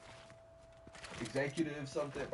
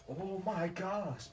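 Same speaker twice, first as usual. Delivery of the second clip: Oh my gosh, higher and slow; higher exasperated disbelief; 7 semitones higher, much slower than usual. Real, not acted. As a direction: repeat Oh my gosh, pitched up in disbelief. Reacting to brother's reply.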